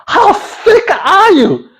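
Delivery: slow, high, sneering disbelief; mock exasperation. Mocking a mate who can't spell his name. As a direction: drag it out like talking to a dimwit. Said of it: "mocking a slow friend".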